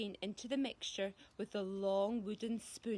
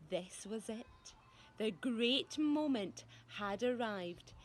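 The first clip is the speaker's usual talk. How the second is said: ordinary delivery, nothing marked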